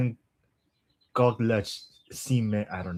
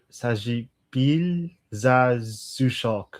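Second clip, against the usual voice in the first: slow syllable by syllable; much slower than usual. Shown as a demonstration. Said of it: "attempting Russian tongue twister".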